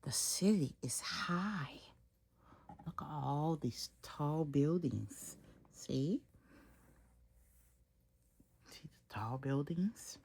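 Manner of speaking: hushed whisper of the city. Acted. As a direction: breathe it softly like a secret. Reading city's hush to child.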